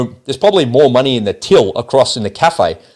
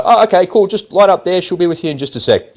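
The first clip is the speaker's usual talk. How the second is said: breezy bank greeter; 7 semitones higher than usual. Retold or imitated. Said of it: "he'll go".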